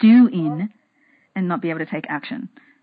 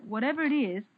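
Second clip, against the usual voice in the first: ordinary delivery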